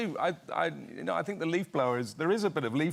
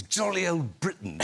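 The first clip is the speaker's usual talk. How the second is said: lightly mimicked royal catchphrase, pitched up; imitating Prince Charles; 5 semitones higher than usual. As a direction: relish the royal catchphrase, higher. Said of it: "I thought it was so funny".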